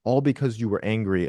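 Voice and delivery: usual speaking voice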